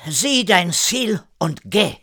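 put-on high, drawn-out French-accented order; loud command. A narrator's reading. René interrupting Erik's plea, forceful slow command. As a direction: command it slowly and firmly. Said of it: "mit einem lauten Befehl".

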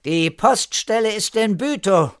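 high, loud called-out statement; calling out. A narrator's reading. Erika calls on to the postman, calling out. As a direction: call it across to someone. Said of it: "Erika rief weiter".

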